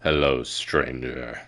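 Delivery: low, slow, quiet stranger greeting; trial character voice. Shown as a demonstration. Streamer auditioning a voice for a stranger, low slow greeting. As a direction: drop deep and stretch it out slowly. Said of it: "what voice should they have".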